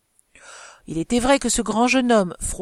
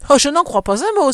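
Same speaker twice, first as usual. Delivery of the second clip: loud, higher cry of disbelief; exclaiming; 6 semitones higher, 7 dB louder than usual. A narrator's reading. Madame de Morescan disputes the countess's claim, exclaiming. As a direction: burst out in lively protest. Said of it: "s'écria madame de Morescan".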